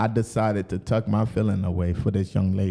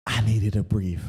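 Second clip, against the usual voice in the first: ordinary talk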